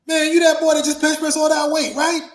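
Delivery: loud, high-pitched put-on voice; imitated stranger. Stranger accosts wrestler lacing his boots, loud put-on voice. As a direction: raise the pitch and call it out. Mimics stranger approaching Tony backstage.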